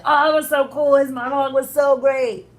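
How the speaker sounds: high, loud, overdone enthusiasm; mock gushing fans. Imitating everyone raving about the speech, mock gushing. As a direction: gush like an impressed fan. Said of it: "'Cause they're like".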